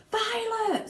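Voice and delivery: very high frantic cry; screamed. Acted. Mother screams to her swelling daughter. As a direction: shriek her name. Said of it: "screamed Missus Beauregard".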